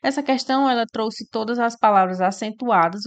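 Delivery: ordinary delivery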